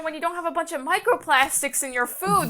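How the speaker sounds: normal talking voice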